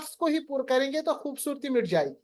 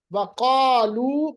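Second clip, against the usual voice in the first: slow, louder heavy recitation; Quran recitation; 5 dB louder, slower than usual. Shown as a demonstration. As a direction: chant it with a full, heavy qaf. Teacher recites Quran words.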